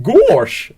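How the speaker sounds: loud, high cartoon "Gosh!"; Goofy gosh. Shown as a demonstration. Host showing how to get into Goofy's voice, with a loud high "Gosh!". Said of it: "if I've had to do an impression of Goofy".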